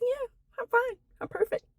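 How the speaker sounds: bright, high put-on "I'm fine"; forced cheerful. To husband while hiding tremors, falsely cheerful and high.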